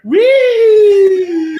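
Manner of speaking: high, loud drawn-out siren wail; long held high "wee"; imitated siren. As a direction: wail like an approaching police siren. Imitating a siren as police arrive.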